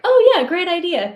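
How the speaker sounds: high, loud, gushing fake enthusiasm; mock polite agreement. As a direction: chirp it like hollow politeness. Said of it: "being polite".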